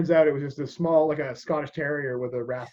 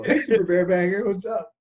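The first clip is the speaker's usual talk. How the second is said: teasing classmate; 4 semitones higher than usual. Retold or imitated. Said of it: "not a nickname you wanna get".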